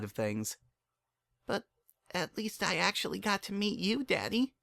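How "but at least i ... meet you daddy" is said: put-on high, soft girlish voice; tender young Dinky. A narrator's reading. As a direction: find the bright side gently. Dinky softly to father.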